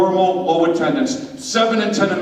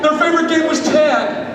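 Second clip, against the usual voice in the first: lively storybook narration; storytelling narration; 7 semitones higher than usual. Acted. Preacher narrating a skit to the congregation, storybook style. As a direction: tell it like a children's tale.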